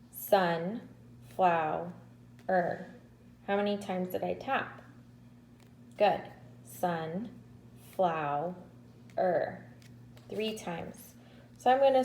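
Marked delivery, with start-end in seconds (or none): 0.1-1.9 Sunflower slowed into its tapped beats, sun-flow-er; syllables tapped out. Shown as a demonstration. Teacher tapping out syllables for kindergartners, slowly. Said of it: "tap our hands as we say the word sunflower". 6.8-8.5 Sunflower slowed into its tapped beats, sun-flow-er; syllables tapped out. Shown as a demonstration. Teacher tapping out syllables for kindergartners, slowly. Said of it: "tap our hands as we say the word sunflower".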